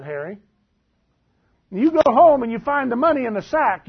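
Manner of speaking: usual speaking voice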